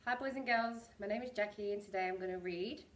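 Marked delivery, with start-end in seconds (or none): none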